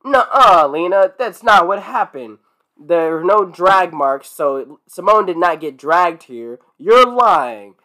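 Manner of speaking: loud, emphatic mock accusation; indignant sleuth calling out a lie, each point hit hard; Velma. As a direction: act out a know-it-all's objection, loud and deliberate. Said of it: "Velma decides now to be like".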